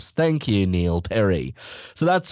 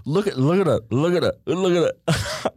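mock llama-jawed plea; jaw jutted out; 6 semitones higher than usual. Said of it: "bottom jaw out like she was a llama".

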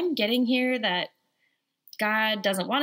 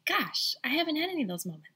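higher, mock self-satisfied voice; smug new mom; 6 semitones higher than usual. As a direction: tease the proud new mother. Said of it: "you're thinking".

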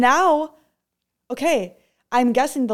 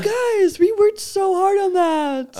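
mock builder lament; 10 semitones higher, 5 dB louder than usual. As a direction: play the heartbroken statue builders. Statue builders lamenting its fall.

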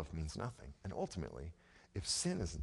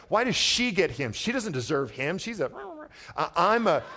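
angsty jealous voice; 13 semitones higher, 12 dB louder than usual. Retold or imitated. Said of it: "It can be kinda angsty".

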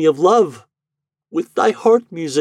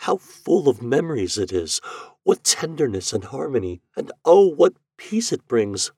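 Eloise's wonder; 6 semitones higher than usual. A narrator's reading. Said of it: "murmured Eloise".

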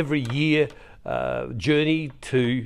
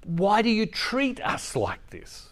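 mild exasperated parent; 6 semitones higher, 3 dB quieter, slower than usual. Retold or imitated. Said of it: "said with great angst and great anger".

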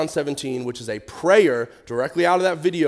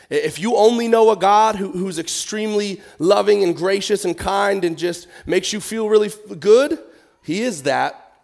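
passionate preaching; 6 semitones higher, 5 dB louder than usual. Real, not acted. Preaching at rising force.

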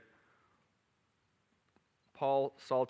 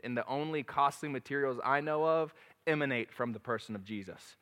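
ordinary talk

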